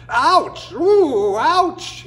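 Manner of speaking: high, loud pained yelps. Acted. Coyote yelps as thorns scratch his nose. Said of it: "he yelped".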